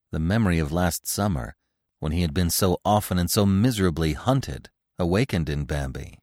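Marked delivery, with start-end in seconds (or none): none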